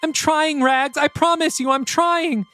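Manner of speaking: high, broken and apologetic; a mother pleading forgiveness; tearful mother. Acted. Chris to her daughter, tearful and high.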